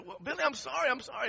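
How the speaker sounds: retold high, insistent apology; pleading apology. Apologizing to the stranded coworker, pleading and high-pitched.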